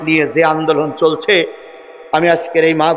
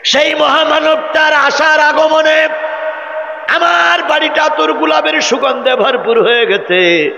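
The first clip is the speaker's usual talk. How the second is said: high, slow, rising cry; awed proclamation; 11 semitones higher than usual. Acted. Mother telling her son about the great man, high slow cry. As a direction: proclaim it with awe, stretched out.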